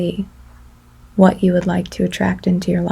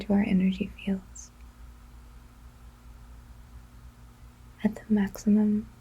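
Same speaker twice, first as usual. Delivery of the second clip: guided meditation; 10 dB quieter than usual. Acted.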